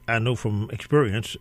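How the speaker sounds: ordinary talk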